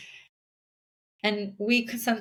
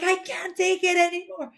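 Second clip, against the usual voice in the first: high, strained mock yell; mock scream at kid; 9 semitones higher than usual. Overwhelmed parent screaming at child. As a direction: pretend to scream at your kid. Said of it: "scream at my kid".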